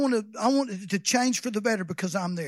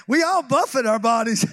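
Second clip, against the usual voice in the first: ordinary delivery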